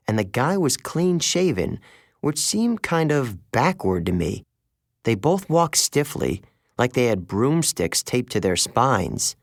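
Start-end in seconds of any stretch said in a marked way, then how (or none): none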